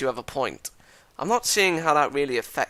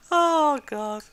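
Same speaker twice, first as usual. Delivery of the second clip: laughing. Real, not acted. Laughing at own reading.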